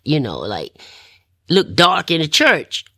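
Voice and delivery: ordinary delivery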